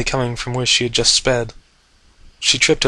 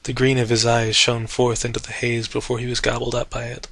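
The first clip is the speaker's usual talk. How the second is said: ordinary delivery, nothing marked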